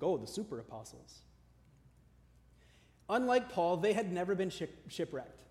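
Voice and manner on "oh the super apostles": softer, lower mock awe; sarcastic. Paul on the so-called apostles, sarcastic. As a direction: toss it off as a sarcastic aside. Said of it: "Paul sarcastically called them super apostles".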